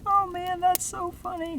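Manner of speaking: put-on very high falsetto child voice; mock kid voice, way up high; delighted kid. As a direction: squeal it like an amused little kid. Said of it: "the kid's like".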